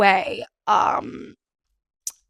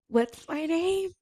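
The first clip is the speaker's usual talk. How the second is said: high, soft and slow, lost for words; mock blank panic; 6 semitones higher, 6 dB quieter, slower than usual. Mocking her on-camera blank, high, soft and slow. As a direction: play the mind going blank. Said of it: "I'm like".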